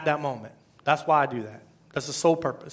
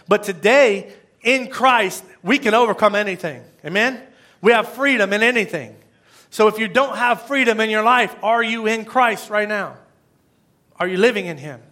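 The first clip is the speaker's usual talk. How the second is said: raised voice, loud and high; emphatic preaching; 6 semitones higher, 5 dB louder than usual. Real, not acted. Preacher challenges congregation about freedom in Christ, emphatic. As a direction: preach it out with force. Preacher building to his main point.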